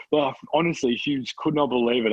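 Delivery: ordinary talk, nothing marked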